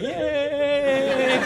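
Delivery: mock delighted cheer; high gleeful yay. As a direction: squeal it very high. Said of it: "ran out of the bowling alley like".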